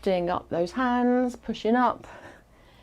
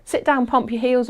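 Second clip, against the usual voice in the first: normal talking voice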